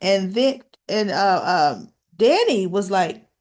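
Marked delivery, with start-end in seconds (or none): none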